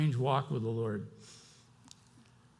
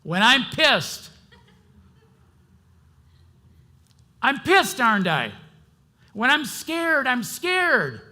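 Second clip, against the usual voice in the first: loud and much higher, pushing out each feeling; preaching at full force, higher and louder; raw honest anger; 8 semitones higher, 8 dB louder than usual. Real, not acted. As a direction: let the anger out for real. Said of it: "I'm gonna say it".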